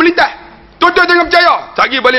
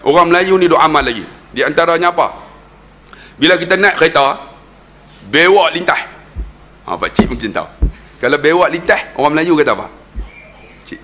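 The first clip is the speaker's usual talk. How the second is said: ordinary delivery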